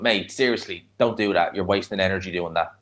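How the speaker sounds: usual speaking voice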